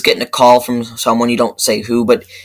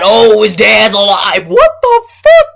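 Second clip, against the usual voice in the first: much higher and louder, a shocked yell; outraged shout; 8 semitones higher, 5 dB louder than usual. Real, not acted. Ranting to viewers about a deleted scene, outraged yell. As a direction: shout it in disbelief.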